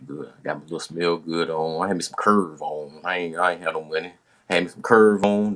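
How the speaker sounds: ordinary talk, nothing marked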